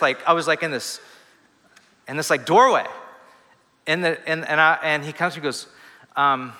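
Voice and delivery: ordinary talk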